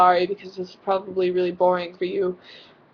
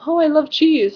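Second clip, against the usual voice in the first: loud, high, breezy "Oh, I love cheese"; mock casual gush; 8 semitones higher, 8 dB louder than usual. Shown as a demonstration. Explaining the word love to her admirer, a light breezy gush. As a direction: toss off "cheese" like a throwaway. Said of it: "the literal meaning".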